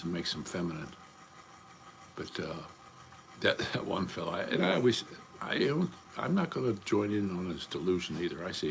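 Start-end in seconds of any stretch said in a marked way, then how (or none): none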